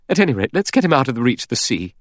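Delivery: high, quick woman's voice; anxious-to-act Vera. A narrator's reading.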